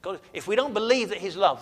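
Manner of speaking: ordinary talk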